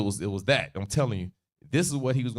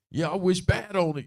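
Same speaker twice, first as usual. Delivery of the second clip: higher-pitched mock cursing; hot, going off; 6 semitones higher than usual. [name] wishing bad on people mid-tirade, heated mock rant. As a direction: keep the rant going, half in play. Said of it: "He's just going off. He's hot".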